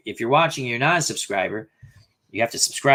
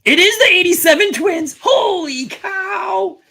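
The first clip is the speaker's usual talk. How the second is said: much higher, loud, holy cow drawn out slow; excited; 6 dB louder, slower than usual. Real, not acted. Learning his eighty-seven Twins answer was right, high excited whoop. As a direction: burst out in high delight.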